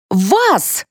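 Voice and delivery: high-pitched, challenging retort; defiant. A narrator's reading. Mother challenging the narrator, defiant. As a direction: throw it back as a challenge. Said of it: "с вызовом ответила мать Марфы".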